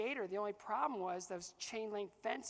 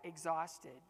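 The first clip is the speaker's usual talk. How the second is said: normal talking voice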